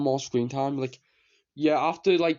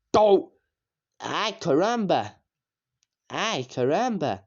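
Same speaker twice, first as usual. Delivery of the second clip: Bart catchphrase; 3 semitones higher, slower than usual. Retold or imitated. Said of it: "I love their catchphrases".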